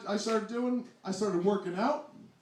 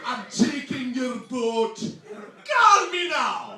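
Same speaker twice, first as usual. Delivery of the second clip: put-on loud, slow, shouted Jamaican accent; Miss Cleo; 4 semitones higher, 12 dB louder, slower than usual. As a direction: holler like a TV psychic with a Jamaican accent. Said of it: "I really mean Miss Cleo".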